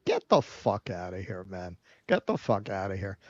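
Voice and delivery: low, hushed brush-off; disgusted dismissal. Real, not acted. Co-host dismissing praise of Joe Biden, low disgusted aside. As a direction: drop low and quiet, dismissing it in disbelief.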